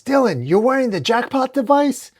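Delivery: high, rising disbelief; high-pitched astonishment; incredulous question. A narrator's reading. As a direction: ask in alarmed surprise. Injured man's incredulous question.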